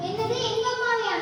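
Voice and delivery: high, startled question; aghast, pitch jumping up; shocked disbelief. Acted. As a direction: exclaim in dismay at the news. Daughter learns mother was abandoned.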